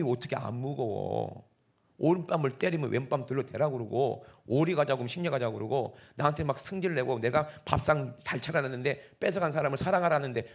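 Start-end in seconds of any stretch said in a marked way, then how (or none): none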